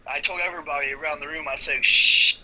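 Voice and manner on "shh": loud, sharp "shh" to hush a crowd; loud shushing. Retold or imitated. Wrestler hushing the party before his demonstration, loud shush. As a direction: shush the whole room. Hushing the room in retold scene.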